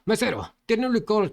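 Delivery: calling out loud for the waiter; shouted call. Acted. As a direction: shout across the room for the waiter. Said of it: "gritó".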